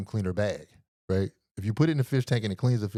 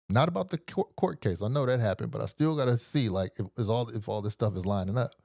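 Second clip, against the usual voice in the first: ordinary talk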